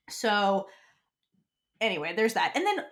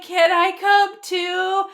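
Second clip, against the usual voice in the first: wide-eyed hopeful sing-song; slow, lilting, eager plea; 8 semitones higher, 6 dB louder, much slower than usual. Retold or imitated. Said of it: "wide-eyed, hopeful".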